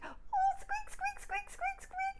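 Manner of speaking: very high squeaky mouse; squeaking mouse. Acted.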